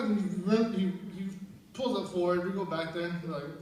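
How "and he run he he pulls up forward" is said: ordinary delivery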